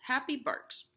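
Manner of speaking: ordinary delivery, nothing marked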